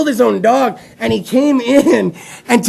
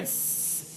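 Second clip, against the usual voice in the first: long voiceless sibilant hiss; snake hiss; 24 dB quieter than usual. Shown as a demonstration. Host leading crowd's snake impressions, drawn-out hiss. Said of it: "do your best snake impression".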